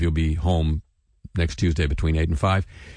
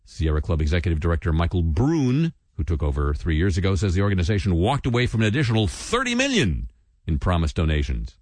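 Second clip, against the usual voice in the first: ordinary talk, nothing marked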